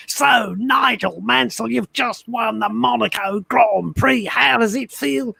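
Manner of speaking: high, slow, excitable commentator voice; excitable Murray Walker. Retold or imitated. Murray Walker impression as race commentator, high and slow. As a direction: ham up Murray Walker asking the winner how it feels. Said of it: "the most excitable, uh, commentator".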